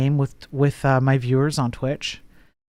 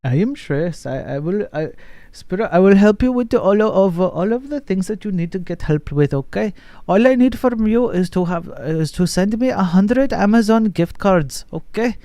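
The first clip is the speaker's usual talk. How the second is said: put-on lightly done Indian call-center accent; Shreyas tech support accent; 5 semitones higher than usual. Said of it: "half decent Shreyas on tech support, uh, impression".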